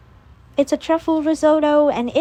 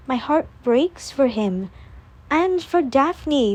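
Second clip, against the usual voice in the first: usual speaking voice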